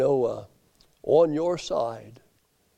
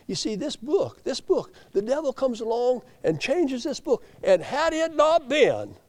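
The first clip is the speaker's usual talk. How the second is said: high, emphatic pulpit delivery; impassioned preaching; 7 semitones higher, 4 dB louder than usual. Acted. Preacher warning that the devil changes scripture, impassioned. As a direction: hold up the book and cry it out high.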